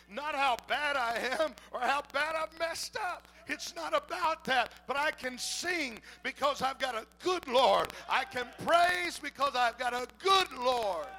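loud, rising preacher's cry building to a shout; preaching at full force. Acted. Preacher rousing the congregation, loud and fervent. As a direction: pour it on like a revival preacher.

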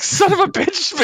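high-pitched curse breaking into laughter; mock anger. Teammates realizing Sinister wants mutant genes, mock furious. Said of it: "they're really mad at Sinister".

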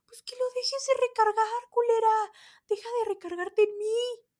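high, loud furious telling-off; angry snap. Retold or imitated. Fed up with the woman elbowing her, a furious shout. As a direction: finally lose it and let her have it.

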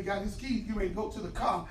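ordinary talk, nothing marked

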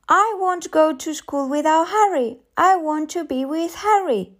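put-on high-pitched little girl insisting; tearful child's protest. A narrator's reading. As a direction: wail a mild protest as a child. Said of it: "she began to weep".

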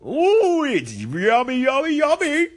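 high squealing delight; delighted tasting. Acted. Retro Dude tasting Arnold's salad, high squealing delight. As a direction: savor it like a thrilled kid.

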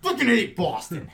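loud, high exasperated cry; exasperated outburst. Real, not acted. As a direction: burst out in comic frustration at Boston. Exasperated outburst after mock grievances.

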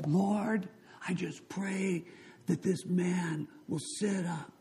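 high, drawn-out mock prayer; earnest prayer. Young pastor prays over dead chief, pleading. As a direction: imitate a pleading prayer, slowing down.

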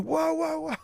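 high, drawn-out mock whoa; playful high-pitched mocking whoa. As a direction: act the bands' amazement. Bands reacting to the dad.

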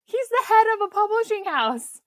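high, incredulous exasperation; exasperated. Real, not acted. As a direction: spell out the obvious in disbelief. Exasperated at nosy wife.

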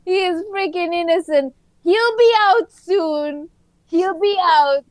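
high, hammed-up reality-show pleading; inmate's devoted girlfriend. Woman insisting jailed boyfriend is innocent, mock pleading. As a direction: protest his innocence in a put-on high voice. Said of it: "that's what the woman sounded like".

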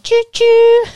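loud, high choo-choo; playful high train hoot; train whistle imitation. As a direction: toot like a toy train, last note held. Train noise for Thomas Tankinson's name.

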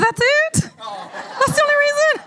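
very high, disbelieving laughing question; mock incredulous. Wife retelling her answer to husband, mock incredulous. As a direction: squeal it in comic disbelief. Acted reaction to husband's reason.

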